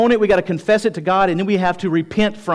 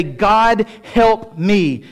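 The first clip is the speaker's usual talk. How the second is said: raised, high-pitched appeal to God; emphatic plea; 4 semitones higher, much slower than usual. Acted. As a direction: call out for help with force. Models surrendering plea, raised.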